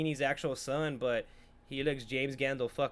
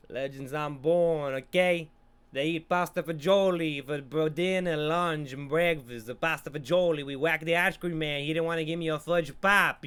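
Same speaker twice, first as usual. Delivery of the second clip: hammed-up Italian-American mobster accent; bad Italian-American accent; 3 dB louder than usual. Viewer spoofing mobster talk, bad Italian-American accent. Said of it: "I'm not doing a racist accent".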